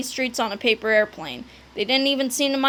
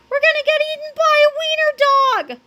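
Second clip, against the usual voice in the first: high, loud, sustained wail; panicked cry; 5 dB louder than usual. A narrator's reading. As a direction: wail in comic terror. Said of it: "cried Cheeseball".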